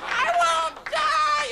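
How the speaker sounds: put-on very high, slow, squeaky kid's protest; defiant child. A child yelling back at the heckler, very high. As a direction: stretch it out like a stubborn little kid. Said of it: "the children".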